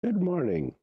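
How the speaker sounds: hoarse gravelly voice from a cold; gravelly, a little under the weather. Real, not acted. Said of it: "Excuse the gravelly voice".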